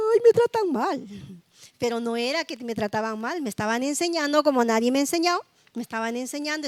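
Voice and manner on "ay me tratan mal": high, teary child's whine, lightly mocked; a mock-pitiful little girl crying, slightly louder; whiny complaint. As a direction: imitate your younger self sulking that she's mistreated. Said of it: "yo me ponía a llorar y decir".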